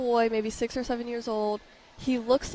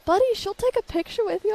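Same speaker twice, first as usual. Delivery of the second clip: higher, encouraging sisterly coax; big sisters coaxing; 11 semitones higher than usual. Retold or imitated. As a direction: nudge the little brother along. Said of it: "his sisters were over there and were like".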